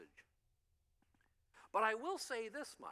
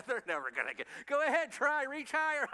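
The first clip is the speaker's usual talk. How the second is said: louder, taunting, teasing voice tossing out a dare; mock gloating God; 6 semitones higher, 7 dB louder than usual. Preacher's mock God taunting mankind to the angels, loud gloating tease. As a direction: tease them like a smug player dangling a prize. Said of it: "Looking at the angels, going".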